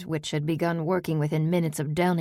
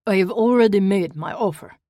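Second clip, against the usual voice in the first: put-on much louder, higher, insistent with an Eastern European accent; 4 semitones higher, 8 dB louder than usual. A narrator's reading. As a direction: stamp down the offer in a thick Eastern European accent. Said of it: "an Eastern European accent thickening his words".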